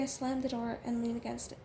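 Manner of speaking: ordinary delivery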